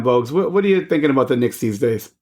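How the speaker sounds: ordinary talk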